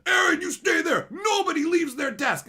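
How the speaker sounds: high, loud mock shout of a bossy manager. Manager ordering everyone to stay, mock shout. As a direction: bark orders at the whole floor. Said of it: "the manager's like".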